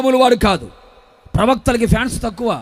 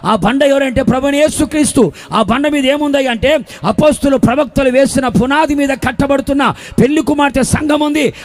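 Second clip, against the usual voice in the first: driving, insistent sermon delivery; full-force preaching; 2 semitones higher, 4 dB louder than usual. Real, not acted. Preacher warning a church without rebukers, full-force preaching. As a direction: hammer out each phrase like a preacher.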